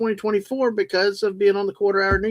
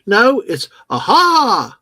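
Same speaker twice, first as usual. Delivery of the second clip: excited aha; 5 semitones higher, 7 dB louder, much slower than usual. Real, not acted. Eureka moment remembering the bug.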